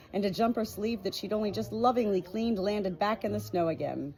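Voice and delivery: ordinary delivery, nothing marked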